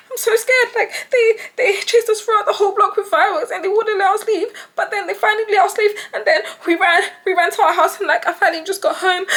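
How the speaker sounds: high, loud fake-panicked call to police; girl faking terror to the police, jittery and breathless; fake scared. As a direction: sell the fright like a scared girl on the phone. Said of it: "'Cause I'm sounding, like, so scared, so, like, jittery".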